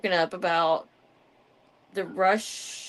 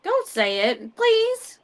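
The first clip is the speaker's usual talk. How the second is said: pleading; 11 semitones higher, 5 dB louder, slower than usual. Real, not acted. Begging friend not to name.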